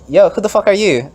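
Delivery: high, loud rude brush-off; mock hostile voter; acted passerby. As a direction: challenge him like a stranger on the street. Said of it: "it's like".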